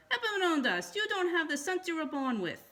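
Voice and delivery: a fed-up mother giving up on her son; exasperated mother. A narrator's reading.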